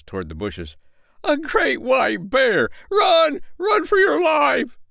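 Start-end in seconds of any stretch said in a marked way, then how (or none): 1.2-2.7 high, loud and slow panicked wail; frightened cry. Acted. Tailor sees the beast in the bushes, loud slow panic. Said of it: "cried the tailor". 2.9-4.8 high, loud yell to flee; panicked shout. Acted. Tailor yells at the tinker to flee, panicked shout. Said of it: "cried the tailor".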